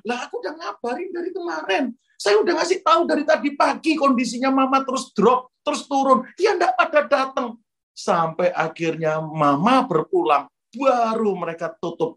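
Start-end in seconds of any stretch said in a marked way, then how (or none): 0.1-7.6 much higher, exasperated protest; acted indignant retort. Sister-in-law protesting she had told them since yesterday, indignant. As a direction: protest as if fed up, high-pitched. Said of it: "panas hatiku".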